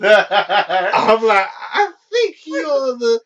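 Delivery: high-pitched, laughing through the words. Real, not acted. Teasing the film's only recognisable Russian, laughing. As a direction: crack up loudly, drawing the words out. Laughing while mocking the casting.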